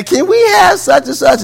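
put-on high eager child's ask; a small kid asking hopefully; little child.

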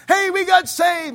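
slow, loud, high shout of joy; excited shout. Retold or imitated. New convert telling parents by phone, shouted joyfully. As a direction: call it out across the ocean, thrilled. Calling parents overseas with news.

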